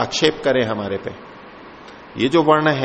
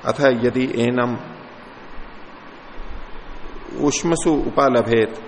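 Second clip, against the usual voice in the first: slow Sanskrit recitation; slower than usual. Acted. Sanskrit verse chanted slowly.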